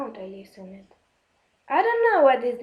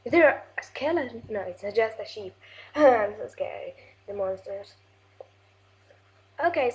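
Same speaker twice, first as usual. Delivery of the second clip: ordinary talk, nothing marked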